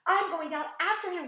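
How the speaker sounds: high, loud determined cry; cried out. A narrator's reading. James telling the others he's going after the centipede, loud cry. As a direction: call out bravely before the leap. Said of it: "cried James".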